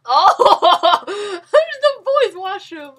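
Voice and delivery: high, loud, slow laughing shout; laughing discovery. Real, not acted. Gamer laughing at finding the boys' washroom.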